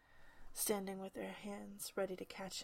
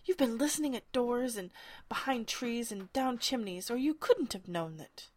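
loud, higher furious accusation; angry Humpty Dumpty accusing Alice; sudden passion; 4 semitones higher, 8 dB louder than usual. Acted. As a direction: burst out in a rage. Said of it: "breaking into a sudden passion".

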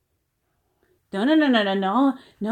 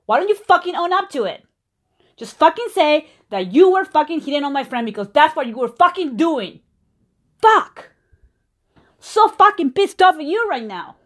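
fiery angry outburst; 3 semitones higher than usual. Acted. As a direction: let it all boil over at him.

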